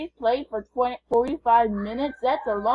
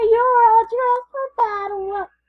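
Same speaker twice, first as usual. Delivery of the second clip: high squeaky kitty voice, meowing; high mewing cat voice calling a friend; 11 semitones higher, 7 dB louder, slower than usual. Pretend. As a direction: pretend to be a little cat. Cat voice continues, slower.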